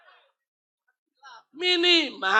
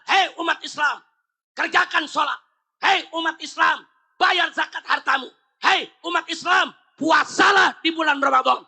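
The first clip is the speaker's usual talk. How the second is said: firm commanding call; 4 semitones higher than usual. Retold or imitated. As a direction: order the faithful, no room for refusal. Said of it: "Nyuruh tegas".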